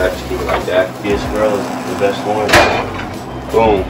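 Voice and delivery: ordinary talk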